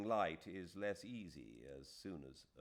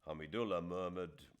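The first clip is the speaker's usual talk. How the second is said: usual speaking voice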